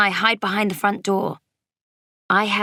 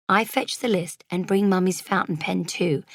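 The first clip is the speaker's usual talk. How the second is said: usual speaking voice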